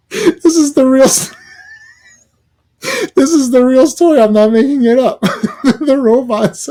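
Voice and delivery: high, loud incredulous laughing; laughing at an absurd plot; laughing in disbelief. Real, not acted. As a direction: laugh it out, barely believing it.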